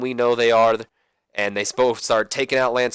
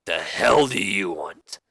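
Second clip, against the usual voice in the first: slow, low grumble of a mock angry boss; angry McMahon; 4 semitones lower, much slower than usual. Boss glaring at his wrestler, slow mock growl. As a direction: spit it out like a cranky boss. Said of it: "staring at him all angrily".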